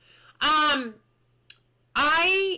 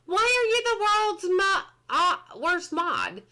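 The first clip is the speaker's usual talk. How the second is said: ordinary talk, nothing marked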